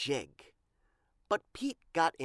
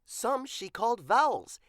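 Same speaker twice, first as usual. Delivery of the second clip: plain reading voice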